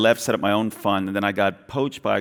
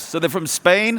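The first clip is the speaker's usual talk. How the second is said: ordinary talk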